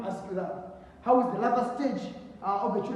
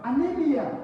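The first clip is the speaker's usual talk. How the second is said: loud answer; 7 semitones higher, 7 dB louder than usual. Real, not acted. Teacher answers own question loudly.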